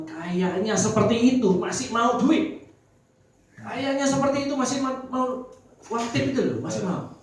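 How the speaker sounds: disbelief, rising; incredulous. Real, not acted. Incredulous exclamation to audience. (heran tak percaya)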